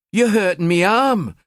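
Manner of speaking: high complaining Geordie whine; northeastern accent. Acted. Girl to man gripping her arm, high northeastern complaint. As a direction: whinge about your arm in a Newcastle accent. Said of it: "she complained in her northeastern accent".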